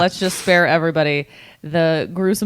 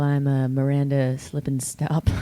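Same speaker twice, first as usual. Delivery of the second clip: radio DJ voice; 4 semitones lower than usual. Pretend. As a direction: croon it like a late-night DJ. Mock radio DJ intro.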